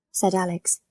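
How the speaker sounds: usual speaking voice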